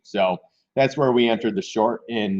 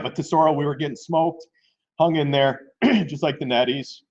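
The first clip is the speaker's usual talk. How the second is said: ordinary delivery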